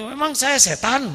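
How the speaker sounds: high, indignant retort; mock the husband's huffy reply; offended husband. Retelling the husband snapping back at his wife, high and indignant.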